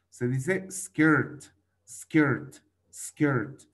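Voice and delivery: "skirt" said slowly with an er sound, skert; slow, clear "skirt" drill; correct English. Shown as a demonstration. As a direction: model "skirt" as skert, repeated.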